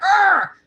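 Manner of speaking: mock toddler tantrum yell, high and loud; imitating a furious three-year-old's yell; tantrum roar. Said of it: "it was more like".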